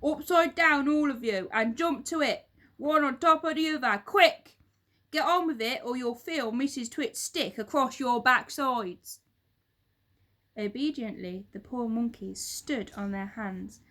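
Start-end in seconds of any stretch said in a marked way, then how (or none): 0.0-9.2 loud barked command; fearsome monkey trainer. A narrator's reading. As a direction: bark it at the caged monkeys. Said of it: "fearsome monkey trainer voice".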